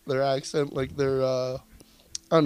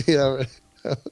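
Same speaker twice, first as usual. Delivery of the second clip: normal talking voice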